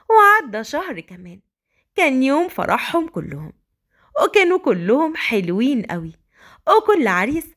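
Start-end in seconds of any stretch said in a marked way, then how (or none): none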